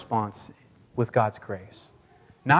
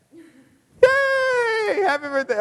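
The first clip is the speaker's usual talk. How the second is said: very high squeal of yay; excited cheer; 4 dB louder, much slower than usual. Real, not acted. Preacher cheering kids' Happy Birthday, very high squeal. Joining kids singing to Jesus.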